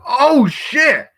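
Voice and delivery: high, loud astonished shout; mock shock. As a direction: shout it like a stunned viewer at a big reveal. Viewer reaction to surprise cameo.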